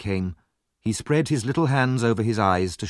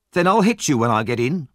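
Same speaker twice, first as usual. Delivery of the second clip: threatening bully; 5 semitones higher, 5 dB louder than usual. A narrator's reading.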